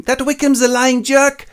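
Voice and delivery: high, loud catty jab; bitchy sister. Retold or imitated. Sister sneering at Wickham, high and loud. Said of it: "bitches around going".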